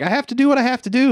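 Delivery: high, fast melodramatic declaration; mock dramatic resolve. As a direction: rush it out like a movie heroine. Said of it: "but she's like".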